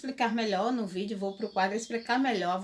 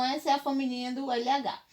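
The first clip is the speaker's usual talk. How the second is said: ordinary talk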